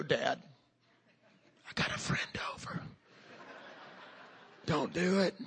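low, whispered aside to a parent; embarrassed son whispering; hushed plea. Retold or imitated. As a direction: whisper it urgently so the guest won't hear.

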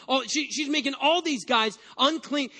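ordinary talk

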